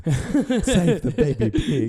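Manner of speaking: slow, high plea through laughter; hammed-up drawn-out cry, cracking up; laughing mock plea. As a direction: draw it out high while laughing.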